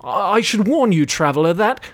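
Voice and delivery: a timid innkeeper warning; lightly voiced jittery barman; barkeep voice. A narrator's reading.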